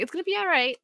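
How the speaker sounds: high-pitched imitation of a reassuring friend; retold reassurance, lightly put on; friend's reassurance. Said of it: "my friend's like".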